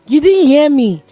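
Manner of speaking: normal talking voice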